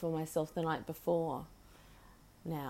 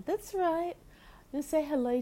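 that's right squeaked up high; high pet talk; 9 semitones higher, 3 dB louder than usual. Real, not acted. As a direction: chirp it back to the bird. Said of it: "talking to the bird".

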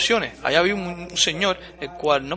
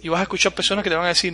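ordinary talk

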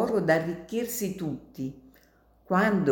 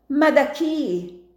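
urgent question; 9 semitones higher, 5 dB louder, slower than usual. Acted. Martino urgently asks.

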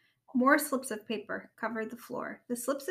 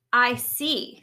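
loud, irritated retort; snapped; 8 dB louder than usual. A narrator's reading. Rabbit told no by the frog, snapping. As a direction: snap back, put out. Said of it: "snapped Rabbit".

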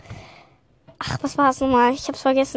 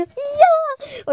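begeistertes Ja; 6 dB louder than usual. Retold or imitated. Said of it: "Ich so".

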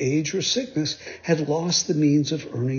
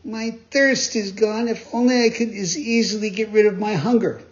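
grumbling; 7 semitones higher than usual. Acted. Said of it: "he grumbled".

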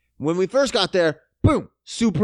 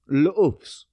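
mock French; 3 semitones lower than usual. Shown as a demonstration. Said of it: "That's French for egg".

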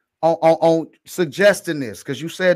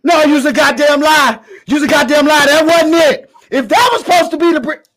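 playful shout; 6 semitones higher, 5 dB louder than usual. Real, not acted. To co-host who faked a British accent, loud mock outrage. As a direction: call her a liar, loud and teasing.

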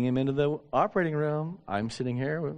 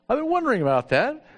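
"wondering" lifted high and louder, faking concern; mock earnest; 10 semitones higher, 5 dB louder than usual. Pastor pretending to his secretary he'd noticed the cyst, high and louder. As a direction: overplay it like you knew all along. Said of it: "I hadn't seen it ever".

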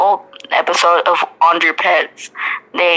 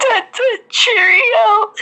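mock British accent; 14 semitones higher, slower than usual. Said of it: "What the fuck?".

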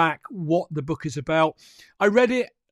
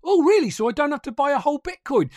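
high, quick surprised question from a friend; a friend amazed to hear they need not buy a whole coin, high and quick; surprised newcomer; 7 semitones higher, 3 dB louder, faster than usual. Retold or imitated. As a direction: play the amazed newbie who just learned something. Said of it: "And they'll go".

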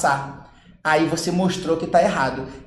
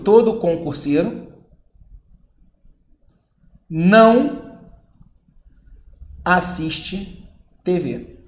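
dictating slowly; slower than usual. Shown as a demonstration. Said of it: "vamos pegar aquele exemplo".

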